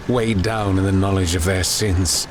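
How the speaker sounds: ordinary delivery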